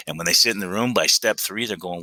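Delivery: normal talking voice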